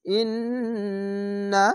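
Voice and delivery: ইন্না with long humming nn held; nasal ghunna. Shown as a demonstration. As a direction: draw out the nn in ইন্না through the nose. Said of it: "এখানে ওয়াজিব গুণনা". (ইন্না গুন্না দিয়ে টেনে)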